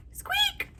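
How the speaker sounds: tiny high squeak; high mouse squeak. Acted.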